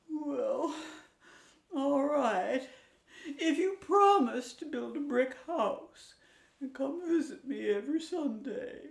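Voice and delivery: a mama pig giving in; high weary mother pig; reluctant mama pig. Acted.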